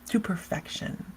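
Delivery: breathy whisper of "to perfection"; hushed, almost under the breath. Real, not acted. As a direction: drop to a whisper. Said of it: "trailing whisper".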